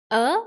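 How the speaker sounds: UH swept sharply upward; rising tone. Shown as a demonstration. Teacher leads the three A's, UH rising. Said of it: "Let's say that again with me".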